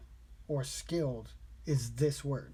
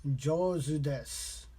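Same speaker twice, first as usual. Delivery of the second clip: slow, careful learner's pronunciation of Jozu desu; slow practice take of Jozu desu; slower than usual. Shown as a demonstration. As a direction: say Jozu desu slowly, syllable by syllable. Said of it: "I'm gonna slow it down on my end".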